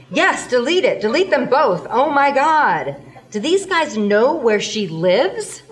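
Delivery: loud, high, horrified plea; panicked shout. Acted. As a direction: yell it in disgust and alarm. Panicked demand to delete videos.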